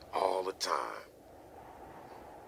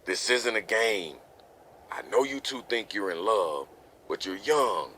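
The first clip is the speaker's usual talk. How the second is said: exasperated father, raised pitch and volume; angry parental scolding; 4 semitones higher, 4 dB louder than usual. Acted. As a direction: lecture the young couple, building in force. Father lectures young couple about risks.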